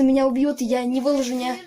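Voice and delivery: usual speaking voice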